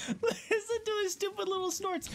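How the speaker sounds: very high, laughter-choked squeal; giggling squeal. Real, not acted. Player amused by the animal's snorts, giggling squeal. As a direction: squeak it through helpless laughter.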